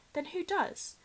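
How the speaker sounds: startled question. Acted. Harry startled.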